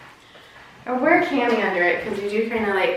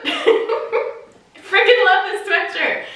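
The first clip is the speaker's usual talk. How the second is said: laughing; 7 semitones higher, slower than usual. Real, not acted. Laughing gush over sweatshirt.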